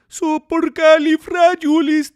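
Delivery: high, loud, slow mock Indian accent; accent turning Indian. Streamer's tío impression gone wrong, slipping into an Indian accent. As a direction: Mexican accent that slides into Indian. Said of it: "my tío Beto's turning Indian".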